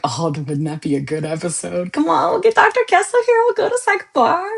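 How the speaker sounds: high, eager squeal of an idea; excited pitch-up. Retold or imitated. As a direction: pitch it up like a sudden brilliant plan. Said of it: "which I was like".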